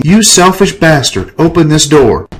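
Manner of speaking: high, loud angry yell; screaming. Acted. Woman pounding on his door, screaming angrily.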